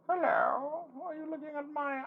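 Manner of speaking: very high, silly cartoon rabbit voice; high-pitched, drawn-out mock rabbit; put-on Jax voice. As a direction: squeak it out like a toy rabbit putting on a character voice. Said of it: "I don't think he talks like that".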